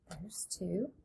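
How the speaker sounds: whispered aside while counting. Shown as a demonstration. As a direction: whisper the number softly. Teacher counting dots.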